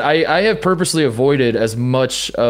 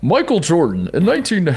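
nineties announcer voice; 5 semitones higher than usual. Retold or imitated. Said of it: "Can you do the whole show in that voice".

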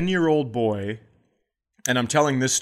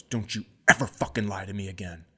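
angry dad; 6 semitones lower, 4 dB quieter than usual. Retold or imitated. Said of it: "the dad goes".

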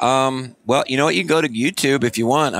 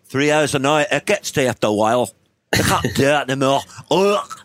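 higher, garbled Irish rocker; mock Irish singer, worn out by gigs; mangled Irish brogue with a strangled groan; drowning Irish accent; 4 semitones higher than usual. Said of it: "sounds like an Irish guy drowning".

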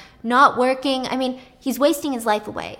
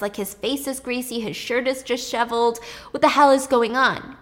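ordinary delivery

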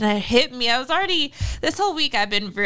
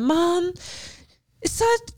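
lightly voiced child, higher pitch; a hint of a sad little boy, pitched up; 9 semitones higher, much slower than usual. Son starts complaining to mom in the car, lightly sad child. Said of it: "looking really sad".

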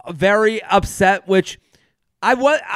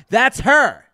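ordinary talk

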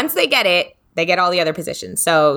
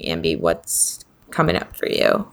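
usual speaking voice

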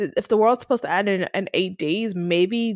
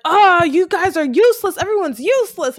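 frustrated complaint; 7 semitones higher than usual. Retold or imitated. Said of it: "he's just like".

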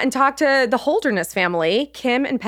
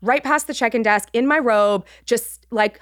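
ordinary delivery

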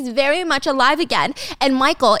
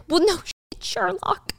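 sarcastic whisper; 8 semitones higher, 4 dB quieter than usual. Retold or imitated.

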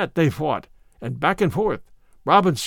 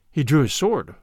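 ordinary delivery, nothing marked

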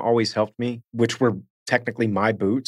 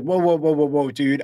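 raised, rapid-fire mock objection; urgent protest; 4 semitones higher than usual. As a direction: retell stopping someone in their tracks. Acting out his protest on set.

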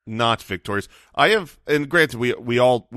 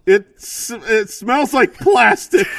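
muffled; 9 semitones higher, 6 dB louder, slower than usual. Real, not acted. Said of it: "sounds like you're in a bathroom".

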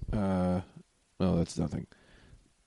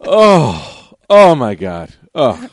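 loud crazed moaning; overwhelmed with pleasure; goes crazy; 8 dB louder, much slower than usual. Acted. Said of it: "he goes crazy".

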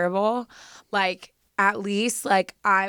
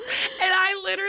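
crying; 11 semitones higher than usual. Real, not acted. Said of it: "Sorry, I didn't mean to get emotional".

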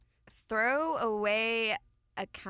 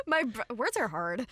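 usual speaking voice

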